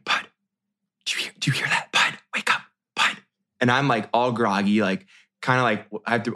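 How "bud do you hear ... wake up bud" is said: urgent whisper, no voice at all; mom whispering. Retold or imitated. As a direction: whisper him awake in the dark. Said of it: "She's whispering to me".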